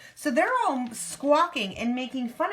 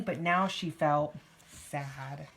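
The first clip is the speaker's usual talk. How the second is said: sad narration; 6 semitones lower, much slower than usual. A narrator's reading. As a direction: let it sink sorrowfully onto the last word. Narration sinks with character's sadness.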